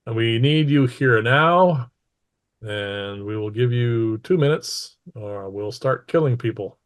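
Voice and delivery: ordinary talk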